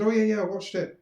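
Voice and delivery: mock-casual imitation of the friend; airy excuse. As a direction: toss it off like a careless friend.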